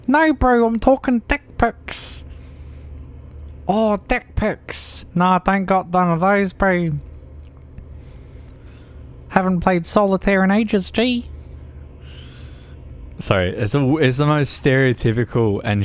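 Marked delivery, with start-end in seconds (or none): 0.1-11.3 high, exaggerated Kiwi bloke voice; hammed-up New Zealand accent. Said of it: "attempt at a New Zealand accent".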